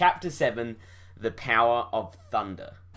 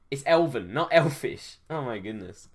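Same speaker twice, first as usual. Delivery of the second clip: ordinary delivery, nothing marked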